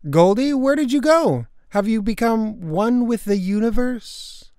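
high call, slowing into wonder; searching call. Acted. Girl seeking her vanished pet, high wondering call. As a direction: call out into the open for someone lost.

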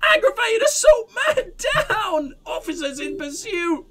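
very high frantic scream fading to a laugh. Acted. Crazed woman screaming codes into her megaphone. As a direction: shriek the codes wildly. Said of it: "the megaphone and screams".

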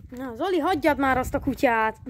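ordinary talk

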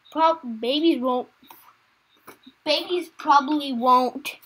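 ordinary delivery

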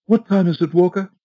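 high, loud, fretful lady's voice; a nervous wife calling to her butler; anxious Mrs Foster. A narrator's reading. Anxious wife asks the butler the time, high and fretful.

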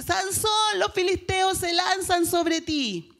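grito agudo y fuerte, lento en la segunda parte; grito de alarma. Acted. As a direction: Dalila alerta a Sansón a gritos. Dalila llama a Sansón. (Dalila avisa a Sansón: grito fuerte y agudo)

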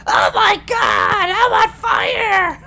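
high, slow, shrieking panic; panicked scream. Retold or imitated. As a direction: scream like you're on fire. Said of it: "I still love that".

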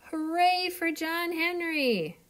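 high cheering crowd voice; excited cheer. Acted. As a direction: cheer it out for the hero. Townsfolk cheer the hero.

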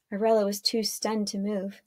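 usual speaking voice

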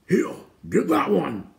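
high, loud, drawn-out gruff order; gruff-voiced holler; gruff shout. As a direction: shout a rough command to a henchman. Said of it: "a gruff voice shouted".

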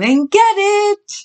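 high, loud singsong summons; calling out. A narrator's reading. Mama at the trough to her pig family, calling out. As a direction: holler it out high, last word held. Mama calls pigs to dinner.

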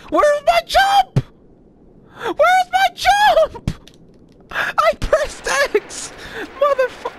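high, loud, slow frustrated shout; outraged yelling. Real, not acted. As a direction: yell at the game in disbelief. Game ignored button, outraged.

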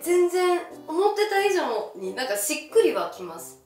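ordinary talk, nothing marked

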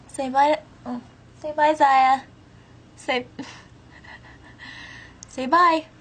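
high cooing pet voice. Real, not acted. Urging the cat to say bye.

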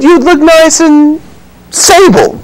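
"sable" high and drawn out, no t; insistent "sable". Acted. Innkeeper denying he said stable, insistent high "sable". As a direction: stress "sable" clearly, stable without the t. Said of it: "You see nothing about a stable".

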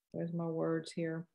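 ordinary talk

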